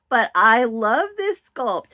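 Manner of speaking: normal talking voice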